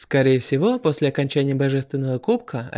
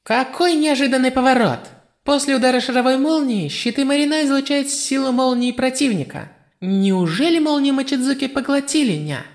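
high, excited goddess announcer; excited commentator shout; 10 semitones higher than usual. A narrator's reading. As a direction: cry it out like an amazed commentator. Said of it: "громко вскрикнула".

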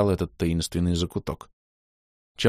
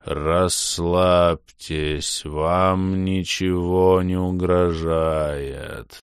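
very slow, eerie distorted voice; much slower than usual. Acted. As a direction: eldritch horror, drawn out slowly. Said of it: "жутко искажённый голос".

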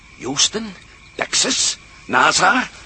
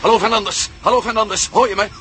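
higher, louder, insistent calling; urgent radio call; 5 semitones higher, 4 dB louder than usual. Acted. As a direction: shout into the set for an answer. Calling over the radio, growing urgent. (dringend roepen via de radio naar Fernandes)

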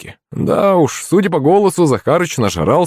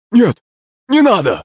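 terrified gasp; 11 semitones higher, 3 dB louder than usual. Acted. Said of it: "выдохнул Захарыч".

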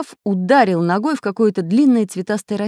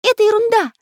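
much higher, chirpy character voice; high cartoon voice; 6 dB louder than usual. A narrator's reading. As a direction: squeak it out like a toy pencil. Magic pencil character speaks.

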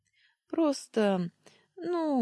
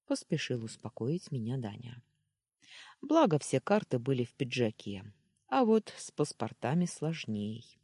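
plain reading voice

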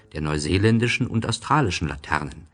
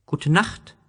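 little prince; 11 semitones higher than usual. Acted. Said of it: "sagte der kleine Prinz aufs Geratewohl".

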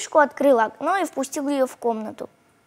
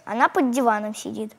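ordinary delivery, nothing marked

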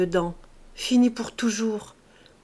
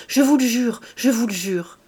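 urgent, loud first, easing on the repeat; hasty oath; 3 semitones higher, 6 dB louder than usual. A narrator's reading. Servant swearing to her suspicious master, urgent. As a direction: blurt out the oath at once. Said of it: "Elle répondit précipitamment".

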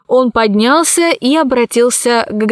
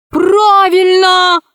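high, drawn-out cry; sudden shout; 9 semitones higher, 3 dB louder, much slower than usual. A narrator's reading. Heiress suddenly backs the protest, shouting high and long. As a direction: shout it out, stretching the word high. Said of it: "закричала вдруг наследница".

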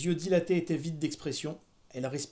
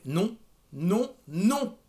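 building refusal, each no higher; firm refusal; 4 semitones higher, 4 dB louder, much slower than usual. A narrator's reading. Mother forbidding the ball, rising insistent refusal. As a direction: cut the daughter off, louder each time.

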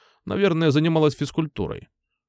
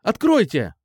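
demanding shout; 8 semitones higher, 3 dB louder than usual. A narrator's reading. Girl pounding on locked door.

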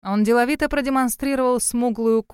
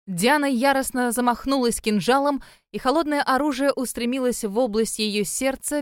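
ordinary delivery

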